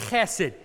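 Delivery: "Chesed" with a throaty, scraped ch; "Chesed" starting from deep in the throat; throaty Hebrew sound. Shown as a demonstration. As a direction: say "Chesed" like something's in the back of your throat. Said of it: "like there's something in the back of your throat".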